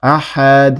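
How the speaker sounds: আহাদ with a bounced final দ; vibrating extra release on the end of আহাদ; echoing qalqala. Shown as a demonstration. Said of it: "একটা দাল extra আসবে".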